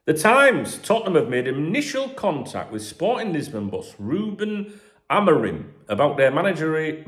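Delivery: loud, high gossip-column read; news read. Acted. Podcaster reading transfer rumours to listeners, news-read style.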